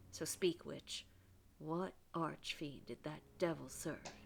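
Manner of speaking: somewhat southern preacher delivering last rites; a rough attempt at a southern accent. Reverend addresses a witch before execution, light southern accent. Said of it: "I've given Reverend Hale a somewhat southern accent".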